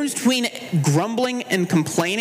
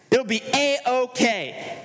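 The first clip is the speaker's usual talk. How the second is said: higher, slow, sing-song fake optimism; mock cheery; 5 semitones higher, slower than usual. Churchgoer faking that suffering is fine, hammed-up cheer. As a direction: stretch it out like a forced smile. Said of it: "called to put on good faces".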